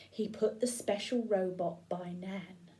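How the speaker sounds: hushed, tiptoe telling; whisper. Acted. Sneaking into Nan's room.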